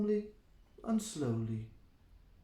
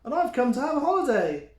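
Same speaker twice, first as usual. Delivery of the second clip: high, laughing goat voice; 6 semitones higher, 6 dB louder than usual. Acted. Mike laughs at the troll, high and laughing. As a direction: laugh it off, cheeky and higher. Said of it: "Middle-sized Mike just laughed".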